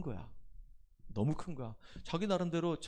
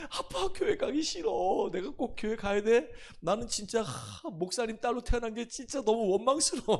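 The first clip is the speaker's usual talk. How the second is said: whining daughter; 9 semitones higher, 6 dB louder than usual. Retold or imitated. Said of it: "막 이러면서". (높고 늘어지게 칭얼대는 딸 흉내)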